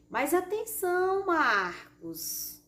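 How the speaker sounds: ATENÇÃO high and drawn out, falling on MARCOS; exclaimed order. Shown as a demonstration. Teacher demonstrating an order to Marcos, exclaiming.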